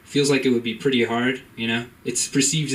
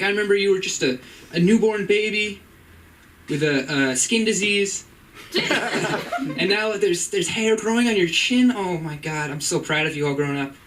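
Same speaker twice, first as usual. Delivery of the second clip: higher mom voice, laughing mid-line; imitating his mom; 6 semitones higher than usual. Son mimicking his mom recalling his baby skin. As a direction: gush like a proud mother. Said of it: "She'd be like".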